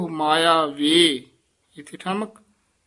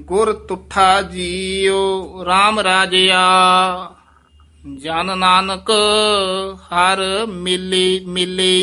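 slow, drawn-out devotional chant, louder; slow gurbani recitation; 2 semitones higher, 6 dB louder, much slower than usual. Acted. Teacher reciting the verse to students, slow devotional chant. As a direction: intone each word slowly and reverently.

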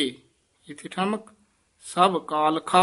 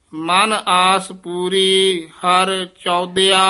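slow, drawn-out devotional chant, louder; slow gurbani recitation; 2 semitones higher, 6 dB louder, much slower than usual. Acted. Teacher reciting the verse to students, slow devotional chant. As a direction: intone each word slowly and reverently.

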